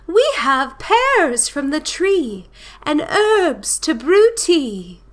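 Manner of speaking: high, slow, drawn-out bird voice; stretched, lilting high character voice; storybook bird voice. A narrator's reading. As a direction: croon it high as a visiting bird. Voices the visiting birds.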